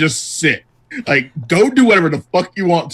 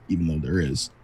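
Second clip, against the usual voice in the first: low, soft aside; under the breath; 10 semitones lower, 9 dB quieter than usual. Pretend. Rescinding the PR statement, muttered under the breath. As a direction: slip it in quietly beneath the official line. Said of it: "Just whisper it under your breath".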